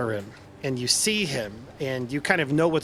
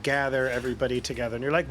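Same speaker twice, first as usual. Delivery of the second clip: ordinary talk, nothing marked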